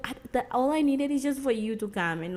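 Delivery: usual speaking voice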